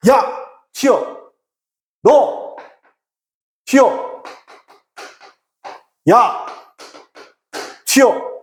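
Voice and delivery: high, punchy one-word calls with gaps; urgent warning call. Acted. Teacher demonstrating subject then verb, high urgent calls.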